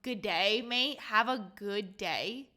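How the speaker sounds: an Aussie slogan, the last words drawn out slow; hamming up an Australian motto; mock Australian slogan. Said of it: "imagine that's your country's, like, your logo".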